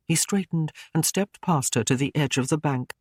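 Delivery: plain reading voice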